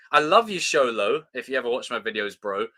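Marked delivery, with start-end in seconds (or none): none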